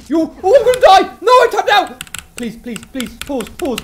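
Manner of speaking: high, loud panicked pleading. Real, not acted. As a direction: beg frantically. About to die in game.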